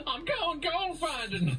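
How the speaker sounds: high, slow laughing prospector voice; mock prospector. Joking about Mark's gold find, slow laughing prospector. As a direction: drawl it like a giddy miner.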